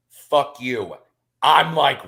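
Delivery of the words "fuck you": ordinary talk, nothing marked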